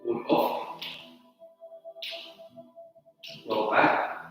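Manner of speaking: soft, sparse form cues over music; name each move quietly as you flow; calling out moves. Acted. Calls tai chi moves during form.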